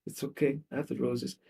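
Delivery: soft, voiced self-reassurance; quietly acted consolation through hurt; understated weeping act. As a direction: reassure yourself softly, lightly tearful. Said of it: "and she's weeping".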